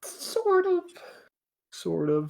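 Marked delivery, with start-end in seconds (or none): 0.0-0.8 very high squeaky voice. Pretend. Repeats phrase, squeaky then normal.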